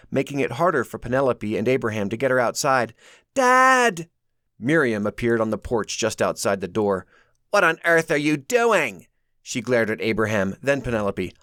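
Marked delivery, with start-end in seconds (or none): 3.3-4.1 high loud cry; desperate cry. A narrator's reading. Mary calling to her dad in terror. 7.5-9.1 indignant demand. A narrator's reading. Miriam demands.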